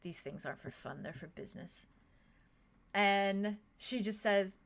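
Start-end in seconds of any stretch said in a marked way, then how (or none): 0.0-1.9 a calm brother gently setting her straight; gentle voice. A narrator's reading. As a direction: lower and softer, gently patient. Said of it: "Caladrius's gentle voice kinda speaks back".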